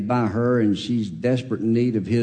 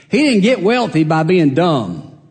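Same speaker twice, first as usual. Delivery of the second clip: ordinary talk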